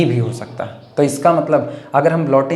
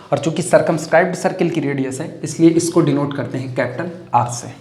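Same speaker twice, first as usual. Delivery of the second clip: ordinary talk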